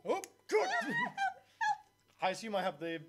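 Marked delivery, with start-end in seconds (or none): 0.1-0.9 sarcastic surprise. Acted. Sudden fall into water.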